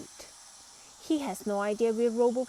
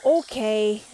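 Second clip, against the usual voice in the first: loud okay; 10 dB louder than usual. A narrator's reading. Pete calls enough.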